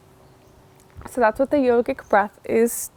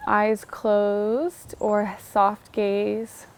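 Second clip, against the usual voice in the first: guided meditation. Acted. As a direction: ease the listener into the breath. Leads the breathwork meditation.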